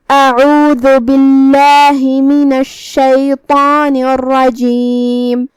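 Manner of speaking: very slow, measured tajweed recitation; Quran recitation. Shown as a demonstration. Teacher opening the lesson's surah, slow Quran recitation. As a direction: recite with long, sustained vowels. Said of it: "سورۃ الزلزلہ کی تلاوت".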